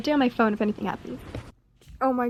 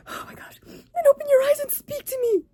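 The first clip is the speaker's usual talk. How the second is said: emotional; 3 dB louder than usual. Real, not acted. Reacting while reading.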